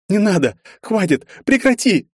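high, loud panicked screams; terrified screaming. A narrator's reading. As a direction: scream to stop him. Героиня кричит в ужасе. (крик в ужасе)

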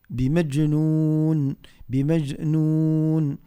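بمجنون with a bounce on the ج; qalqala demo. Shown as a demonstration. Teacher shows the echo on the jim, qalqala demo. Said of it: "فيه قلقلة".

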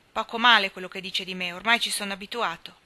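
usual speaking voice

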